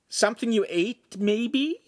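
high-pitched, needling question; sarcastic. A narrator's reading. Lincoln asks the sick Podesta, sarcastic. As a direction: tease with mock helpfulness. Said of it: "Lincoln asked sarcastically".

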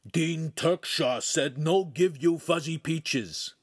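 slow clumsy dog talk. Acted. Dogface relaying the Dean's order, slow dog voice.

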